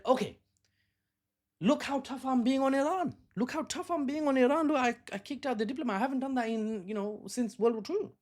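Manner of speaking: high-pitched, mocking show of toughness; hammed-up, high voice of a self-satisfied politician; mock boastful politician. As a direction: parody a leader bragging about being tough. Mocking impression of boastful Prime Minister.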